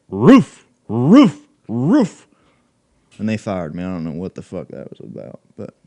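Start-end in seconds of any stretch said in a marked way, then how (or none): 0.1-2.3 barking like a dog; mock dog barks; dog bark roof. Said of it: "And I said".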